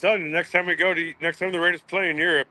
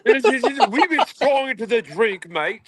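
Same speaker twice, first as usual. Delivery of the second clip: put-on higher, louder Scottish commentator accent; mock Scottish golf commentator, raised pitch; 8 semitones higher, 4 dB louder than usual. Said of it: "he has a really thick Scottish accent".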